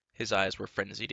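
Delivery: plain reading voice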